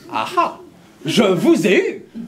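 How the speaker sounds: very high, loud shout, then a laugh; loud mock roar ending in a slow laugh; gruff roar. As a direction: bellow it, then laugh in triumph. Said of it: "d'un gros râle bien rauque".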